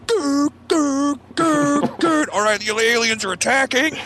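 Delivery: high squawky alien. Retold or imitated. Answering machine alien skit, in a high squawky alien voice.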